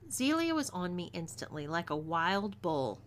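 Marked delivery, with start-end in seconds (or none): none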